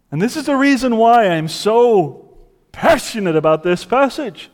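ordinary delivery

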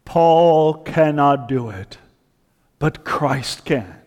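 ordinary talk

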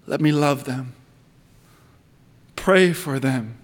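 prayer. Acted. Preacher prays aloud.

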